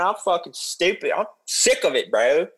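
high-pitched hammed-up pissed-off bro; mock angry rant. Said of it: "I wanna be angry. I wanna be mad".

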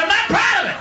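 high-pitched defensive protest; defensive outburst. Acted. Mock-defensive confession, repeated louder.

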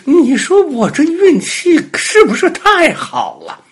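very high, loud puppy voice; delighted little dog. A narrator's reading. Little dog lands safely on tiger's belly, high and gleeful. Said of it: "心里想".

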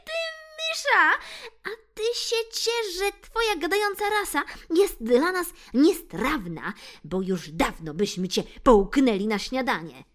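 high, loud sneering tomcat threat; threatening cat. Acted. Bill threatens to swallow the mouse, high sneering voice. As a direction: menace the mouse as a bully cat. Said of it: "zagroził Bill".

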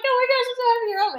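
high, fast gushing; hammed-up breakneck delight, pitched high; mock overjoyed wife. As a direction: imitate a swooning welcome home. Acting Penelope's joy at husband's return.

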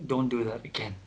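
low, hushed priest's warning; lightly whispered reprimand, voice dropped; mock whisper. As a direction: murmur it like a priest in confession. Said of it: "then he'll be whispering".

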